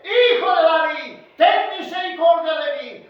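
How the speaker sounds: high, loud cry for mercy; shouted plea. Acted. Preacher acting blind Bartimeo begging Jesus, shouted plea. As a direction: cry out to be heard. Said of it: "gritó repetidamente".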